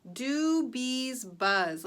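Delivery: slow, loud, each word drawn out; slow reading aloud. Shown as a demonstration. Teacher reading the sentence slowly to the class. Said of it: "here we go".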